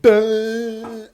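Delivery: high, drawn-out silly noise; stretched high-pitched dumb sound; mock dopey noise. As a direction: let out a long daft noise. Imitated noise during mock call.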